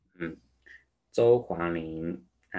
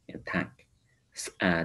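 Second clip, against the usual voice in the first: ordinary delivery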